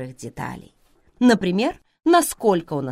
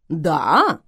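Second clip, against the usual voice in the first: perking up; 7 dB louder than usual. Acted. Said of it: "оживился Грейхру".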